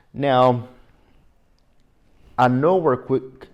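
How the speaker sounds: usual speaking voice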